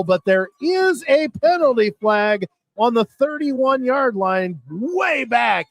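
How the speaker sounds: high-pitched excited call of a breakaway run; excited play-by-play. Real, not acted. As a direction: build to a high excited call, stretching the big yard numbers. Live call of a breakaway return.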